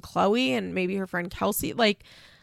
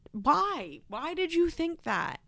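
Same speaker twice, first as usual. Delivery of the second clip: high, softer pleading question; baffled disbelief; 7 semitones higher, 4 dB quieter, slower than usual. Real, not acted. Host baffled at daughter's hopes, high soft disbelief. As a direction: lift it high, softly incredulous.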